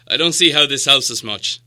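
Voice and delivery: disappointed dwarf. Acted. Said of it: "said Thorin disappointedly".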